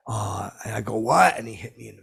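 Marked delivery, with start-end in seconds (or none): 1.0-1.4 sudden high, loud what; sharp rise in pitch and volume; startled question. Retold or imitated. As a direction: blurt it in disbelief. Startled reply before being punched.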